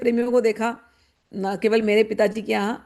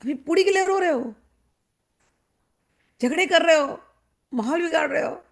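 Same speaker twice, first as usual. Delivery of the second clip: higher, incredulous scolding; mock disbelief; 5 semitones higher than usual. Young girl silently scolding the greedy devotee, higher and incredulous. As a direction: marvel at the hypocrisy. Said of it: "और मैं सोचती थी".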